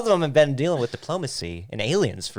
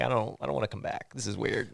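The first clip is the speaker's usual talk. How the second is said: put-on flat, much softer mumble of a bored kid; mumbled bad acting; 4 semitones lower, 12 dB quieter than usual. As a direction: deliver it like the worst actor ever, barely audible. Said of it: "the worst lines delivered ever".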